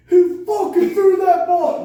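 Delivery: very high, loud, drawn-out bellow; pissed-off coach yell. Retold or imitated. Coach demanding who threw the game ball, imitated furious yell. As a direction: imitate an irate coach hollering, stretching the words.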